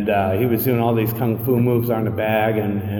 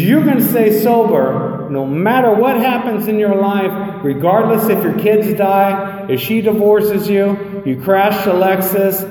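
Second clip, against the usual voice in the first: emphatic promise; 7 semitones higher than usual. Retold or imitated. Sponsor assuring a newcomer he'll stay sober, emphatic. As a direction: drive each phrase home as a firm guarantee. Sponsor delivering the good news.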